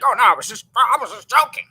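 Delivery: high, loud mock excuse; sheepish backpedal. Vlogger imitating Lucas claiming he was joking, high mock voice. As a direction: play him squirming out of it.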